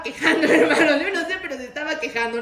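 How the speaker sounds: laughing through the words, fast at the end. Real, not acted. Preacher laughing to the congregation about complaining. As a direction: chuckle as you catch the complainer out.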